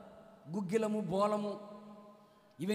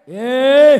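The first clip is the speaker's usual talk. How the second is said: a sudden loud cry of rebuke; loud prophetic rebuke; prophet's shout; 23 dB louder than usual. Retold or imitated. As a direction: bark it like an angry prophet. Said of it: "అంటాడు".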